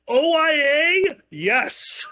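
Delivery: usual speaking voice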